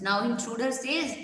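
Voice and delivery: usual speaking voice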